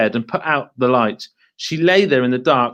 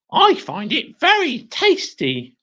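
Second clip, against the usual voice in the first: smug crone; 10 semitones higher than usual. Acted. Mrs Twit voiced.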